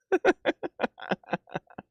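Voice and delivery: high, breath-only fit of laughter; wheezing laugh. Real, not acted. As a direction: laugh until there is no voice left. Laughs about the weekend story.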